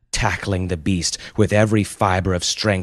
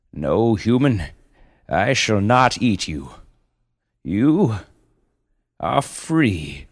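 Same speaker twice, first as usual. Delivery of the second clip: stately creature voice, raised in pitch; solemn panda; 3 semitones higher, 4 dB louder, slower than usual. Acted. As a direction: pronounce it like a noble beast granting mercy. Panda grants mother her freedom.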